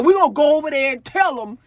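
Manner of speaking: loud, imitated tough friend; mock tough-girl announcement; big strong enforcer. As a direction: throw your weight around, loudly. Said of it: "the big Brutus one, you know, the big strong one".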